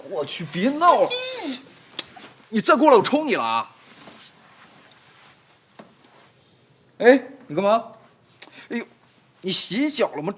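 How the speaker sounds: high, flustered fending off; flustered protest. Acted. Master fending off the clinging maid, flustered. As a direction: push her away in a fluster.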